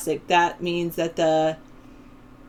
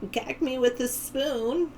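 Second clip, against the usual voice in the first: put-on high, slow valley girl drawl; 7 semitones higher, slower than usual. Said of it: "I speak like a valley girl".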